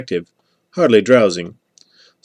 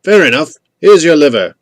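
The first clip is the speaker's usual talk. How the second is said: loud, higher call to someone far off; raised, pitched-up trading call; 6 semitones higher, 8 dB louder than usual. A narrator's reading. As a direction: sing out the reply so it carries. Traders calling across a dark hillside.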